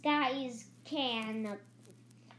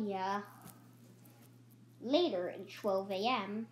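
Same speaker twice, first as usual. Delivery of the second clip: ordinary talk, nothing marked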